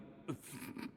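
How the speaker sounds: short soft laugh; a quiet, gently amused laugh. Acted. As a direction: chuckle softly at his question. Amused by the loveless knight.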